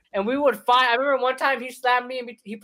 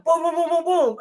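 kick sounds; 7 semitones higher than usual. Retold or imitated. Imitating kicks.

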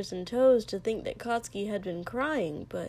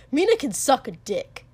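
louder, higher spat insult; 4 semitones higher, 8 dB louder than usual. A narrator's reading. Katsuki dismissing what Mina says, spat out. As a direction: spit the words out. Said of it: "Katsuki spat".

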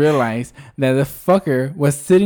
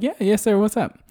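fake cheerful; 8 semitones higher than usual. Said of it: "I'm like".

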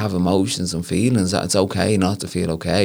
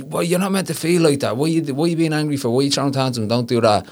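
put-on high, scolding voice telling off a child; scolding parent; 7 semitones higher than usual. Parent scolding a child for being angry, high and sharp. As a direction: ask the kid what's wrong with them, sharp and high. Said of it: "we're told as kids".

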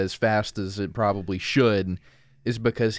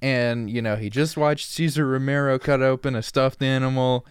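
normal talking voice

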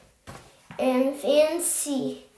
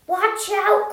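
raised, higher-pitched warning; mild warning cry; 5 semitones higher, 3 dB louder than usual. A narrator's reading. Jack as the dog runs toward water, mild warning cry. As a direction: call it out with a touch of alarm. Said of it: "cried Jack".

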